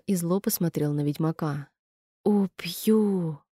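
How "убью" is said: gurgling creature threat; monster threat. A narrator's reading. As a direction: growl it like a wounded beast. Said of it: "произнёс он и снова закричал".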